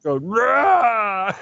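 high mock roar; mock Vader roar. Co-host mocking Vader on the lava, high mock roar. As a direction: yell it like a burnt villain.

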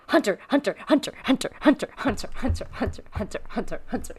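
rapid, repeated nagging for attention; insistent pestering. Retold or imitated. Echo nagging Hunter for attention, insistently pestering. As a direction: pester in a small, urgent voice.

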